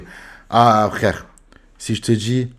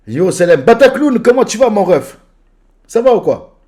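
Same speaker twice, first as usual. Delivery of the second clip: high, loud hyped hello; excited greeting; 10 semitones higher, 7 dB louder than usual. Real, not acted. Streamer hyping a viewer on live, excited greeting. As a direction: greet a friend with big energy.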